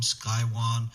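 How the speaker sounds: usual speaking voice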